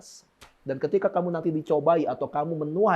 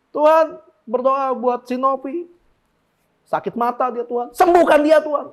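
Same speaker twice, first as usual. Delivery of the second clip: bossy yelling prayer; 7 semitones higher, 6 dB louder than usual. Retold or imitated. Preacher imitating a bossy healing prayer, shouting. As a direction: order God about loudly. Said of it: "teriak-teriak ngomong sembuhkan".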